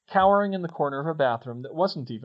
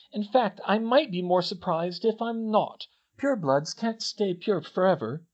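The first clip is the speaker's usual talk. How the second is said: higher-pitched, emphatic lecturer; Hermione explaining; 7 semitones higher, 3 dB louder than usual. Acted. Hermione trying to convince Harry, earnest lecture. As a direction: press the argument point by point.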